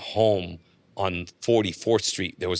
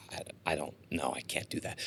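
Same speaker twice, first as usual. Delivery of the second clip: barely audible unsure reply; timid mumble; 7 semitones lower, 14 dB quieter than usual. Retold or imitated. Unsure coworker pushed to do stand-up, answering in a near-whisper. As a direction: shrink away from the offer, almost under breath.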